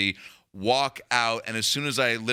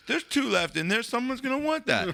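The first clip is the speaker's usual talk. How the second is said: stoned self-talk; 11 semitones higher than usual. Retold or imitated. Acting out his stoned self.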